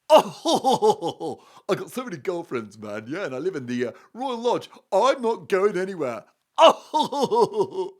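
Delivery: hammed-up guffawing royal impression; Prince Andrew. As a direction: boast between big mock belly laughs. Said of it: "the Prince Andrew laughing".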